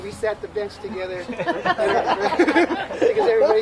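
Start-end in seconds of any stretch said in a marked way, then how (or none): 1.3-3.6 slower, louder laughing delivery. Real, not acted. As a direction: laugh through it. Laughs telling bench story.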